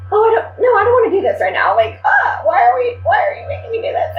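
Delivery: high, loud panicky protest; mock-panicked pleading, pitched high. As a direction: backpedal in a panic. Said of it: "I was just like".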